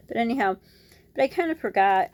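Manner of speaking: normal talking voice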